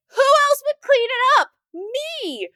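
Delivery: high, loud mock yell; exasperated retort. Mom to son about the mess, mock exasperated yell. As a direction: holler it in hammed-up exasperation.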